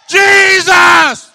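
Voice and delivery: loud, desperate call; crying out. Acted. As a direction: cry his name out loud. Woman crying out to Jesus.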